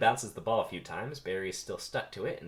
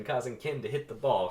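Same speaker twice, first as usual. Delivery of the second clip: ordinary delivery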